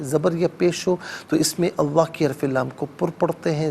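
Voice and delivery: normal talking voice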